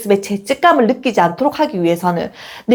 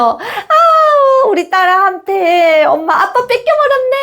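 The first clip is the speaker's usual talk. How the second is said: much higher, drawn-out laughing sigh; playful mock lament; 9 semitones higher, slower than usual. Shown as a demonstration. Mom teasing daughter who claimed dad, playful whine. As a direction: pretend to be robbed, fondly. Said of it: "이렇게 말해줄 수 있어야".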